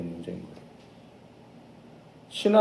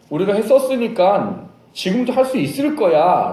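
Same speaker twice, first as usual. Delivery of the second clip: loud, raised, insistent boast; overconfident declaration; 8 semitones higher, 6 dB louder than usual. Acted. Disciples trusting their past success, loud overconfident voice. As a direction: declare it with swelling self-assurance.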